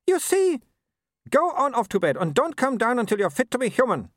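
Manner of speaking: high, imperious scolding; scolding Baroness. A narrator's reading. Mother scolding husband for changing, high imperious voice. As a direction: order him about like an errant dog.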